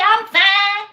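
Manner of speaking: very high, loud Southern reply; copied Georgia accent. Retold or imitated. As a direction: answer in a hammed-up Georgia accent. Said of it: "I be".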